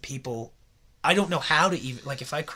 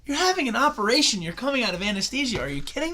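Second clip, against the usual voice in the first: incredulous quote; 9 semitones higher, 5 dB louder than usual. Retold or imitated. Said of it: "She goes".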